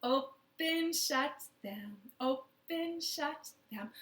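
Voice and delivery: normal talking voice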